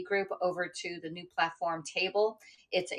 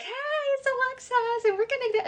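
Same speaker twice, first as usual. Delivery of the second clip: chirpy host voice; 5 dB louder than usual. Retold or imitated. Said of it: "They'd be like".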